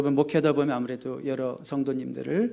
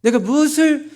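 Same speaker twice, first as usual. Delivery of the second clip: building higher and louder with each plea; a fervent, rising prayer of surrender; earnest pleading prayer; 7 semitones higher, 6 dB louder than usual. Retold or imitated. Pastor modeling the right prayer to God, rising in fervor. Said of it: "이런 기도를 드리길 원하시는".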